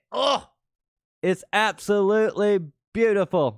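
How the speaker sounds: high, loud delighted cry; excited gush. Real, not acted. Trader thrilled at his chart, excited gush. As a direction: gush over the chart like it's art.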